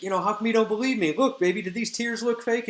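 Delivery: high, rushed, hammed-up whine; mock tearful pleading. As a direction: plead as if pretending to cry. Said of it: "And he's crying".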